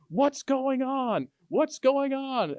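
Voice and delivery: high, slow drawn-out angry demand; mock yelling. Wife charging into the kitchen at a stranger, mock yelling. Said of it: "still and yelling like".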